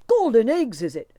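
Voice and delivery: loud, high, scornful cry; angry cry. A narrator's reading. Tim crying out in anger at the wee folk. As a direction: fling the words back at them. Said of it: "cried Tim to the wee folk".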